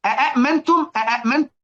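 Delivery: "أأمنتم" opened with three separate glottal stops; three hamzas. Shown as a demonstration. Teacher adding a third hamza for students, each one sounded. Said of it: "ودخلنا عليها همزة تالتة بقت".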